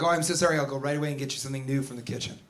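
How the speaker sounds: quick, soft-spoken apology; mock apologetic. Waiter to diner who found a hair, apologetic. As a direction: imitate a waiter hurrying out an apology. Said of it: "the waiter's always very apologetic".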